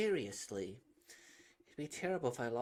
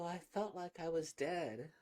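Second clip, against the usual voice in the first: ordinary talk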